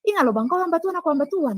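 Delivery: high, insistent reminder; retold admonishing plea. Woman reminds the man they both serve God, pleading. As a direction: re-enact an urgent appeal to conscience, pitched high.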